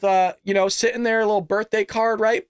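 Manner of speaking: normal talking voice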